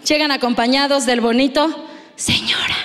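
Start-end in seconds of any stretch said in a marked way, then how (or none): 2.1-2.8 whisper. Pretend. Whispering the dreaded word.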